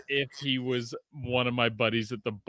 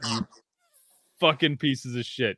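normal talking voice

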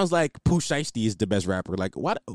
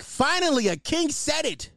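relieved cheer; 10 semitones higher than usual. Retold or imitated. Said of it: "And I was like".

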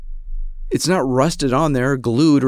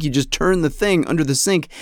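ordinary talk

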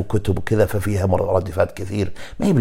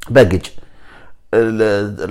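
ordinary talk

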